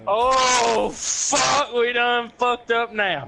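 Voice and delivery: sudden dread, blurting it out high; alarmed curse. Retold or imitated. Recalling spotting a gunman by his truck, a high alarmed curse. Sees a man with a rifle.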